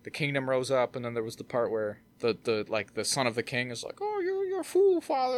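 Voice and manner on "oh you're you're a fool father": much higher voiced prince; scornful son. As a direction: sneer at the father, voice pitched high. Son mocks king in retold scene.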